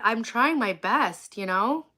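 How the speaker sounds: ordinary talk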